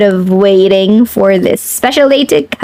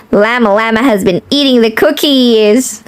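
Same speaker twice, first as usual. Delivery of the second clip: ordinary delivery, nothing marked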